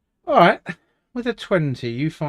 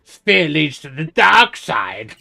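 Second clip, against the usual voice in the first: Yoda; 7 dB louder, slower than usual. Retold or imitated. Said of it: "This sounds like a Yoda".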